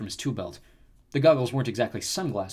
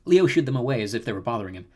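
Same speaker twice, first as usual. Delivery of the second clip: ordinary delivery, nothing marked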